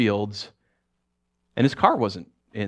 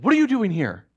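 startled son; 10 semitones higher, 3 dB louder than usual. Retold or imitated. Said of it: "Danny said".

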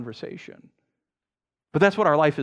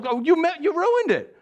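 high-pitched acted complaint; mock outrage; 13 semitones higher than usual. Diner scolding waiter over the ruined sandwich, mock outrage. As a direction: protest in a much higher voice.

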